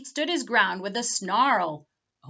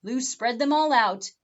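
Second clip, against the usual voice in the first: ordinary delivery, nothing marked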